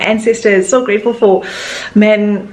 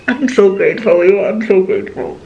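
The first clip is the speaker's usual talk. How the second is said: holding back tears. Real, not acted. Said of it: "Okay, I'm not about to cry".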